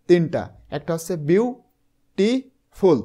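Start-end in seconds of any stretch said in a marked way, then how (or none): 1.3-3.0 বিউটিফুল slowly split into three, বিউ, টি, ফুল, with clear gaps; syllable by syllable. Shown as a demonstration. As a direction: break বিউটিফুল into বিউ... টি... ফুল, pausing after each syllable. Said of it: "তার মানে এখানে কয়টা syllable আছে". (বিউটিফুল ধীরে তিন ভাগে ভেঙে, থেমে থেমে: বিউ, টি, ফুল)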